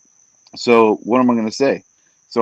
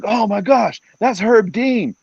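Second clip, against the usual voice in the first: high, excited gasp of recognition; starstruck buddy; 11 semitones higher, slower than usual. Retold or imitated. Friend at the fight spotting the referee, starstruck. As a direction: gush like a fan spotting a star. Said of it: "he's like".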